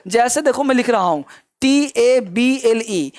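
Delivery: normal talking voice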